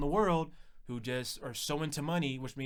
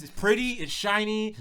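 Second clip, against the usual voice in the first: bright ad pitch; 7 semitones higher, 9 dB louder, slower than usual. Retold or imitated. Ad-like pitch for soda machine.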